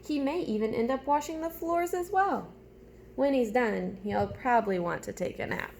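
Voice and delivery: put-on animated storytime voice for young listeners; reading a picture book aloud. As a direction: read it like story time with little ones. Reads picture book to class.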